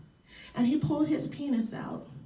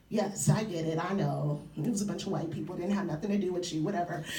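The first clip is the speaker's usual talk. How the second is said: much lower, fast, bored brush-off; dismissive teen; 10 semitones lower, 5 dB quieter, faster than usual. Retold or imitated. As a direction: mumble it off like a sulky teenager. Mocking her teen self's dismissal.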